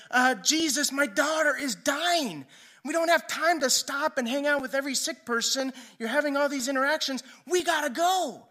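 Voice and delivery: loud, high, urgent plea; retold father begging frantically to hurry; desperate. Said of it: "he's desperate, and he's crying".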